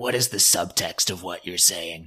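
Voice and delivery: lightly voiced acting coach. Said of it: "old school New York teacher".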